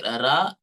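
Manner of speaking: heavy Telugu "ra" with a rising tune. Shown as a demonstration. Telugu teacher shows students a heavy "ra". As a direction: give "ra" a heavy Telugu ring. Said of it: "many variants of ra sound".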